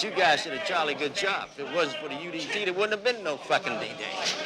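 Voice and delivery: half-hearted stand-in for a British leader; Winston Churchill. Retold or imitated. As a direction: quote a British statesman in your own rough accent. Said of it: "I can't do no Cockney accent".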